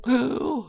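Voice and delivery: high, sudden gasp; sharp gasp. A narrator's reading. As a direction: gasp as the water pulls you under. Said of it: "gasped the poor Toad".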